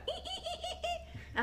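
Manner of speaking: very high, soft squeaking ee ee; guinea pig squeaks. Retold or imitated. Owner imitating her pet begging at the fridge, tiny high squeaks.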